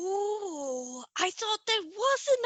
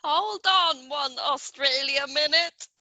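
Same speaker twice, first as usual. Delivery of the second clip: playful mock Australian accent, a bit lower and louder; hammed-up Australian accent, half Southern American by mistake; 6 semitones lower, 4 dB louder than usual. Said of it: "why she's Southern American rather than Australian".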